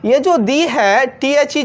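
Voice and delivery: ordinary delivery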